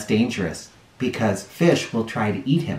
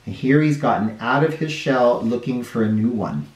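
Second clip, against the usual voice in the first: ordinary delivery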